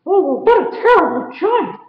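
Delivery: a squeaky little hero crying out in dismay; high cartoon mouse wailing at the giant; Mickey's voice. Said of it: "thought Mickey".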